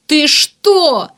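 high, loud horrified shout; wild yell. A narrator's reading. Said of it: "диким голосом заорал Варданян". (дикий возмущённый вопль)

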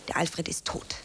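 low hushed whisper. Acted. Actress whispering the death line to her imagined audience. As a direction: let it drop to a breath.

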